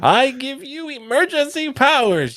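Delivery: high-pitched, drawn-out character impression; mock movie line. Mimics a Star Wars line about Jar Jar.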